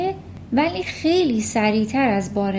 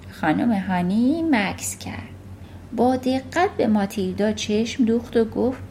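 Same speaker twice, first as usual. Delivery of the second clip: plain reading voice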